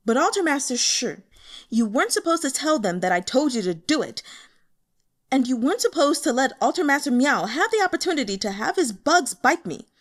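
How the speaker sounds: loud, high whiny protest; aggrieved complaint. Acted. Rotund master complaining to Shi, loud and whiny.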